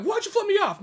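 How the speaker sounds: high, loud demand; mock an affronted man wanting answers; imitating indignant driver.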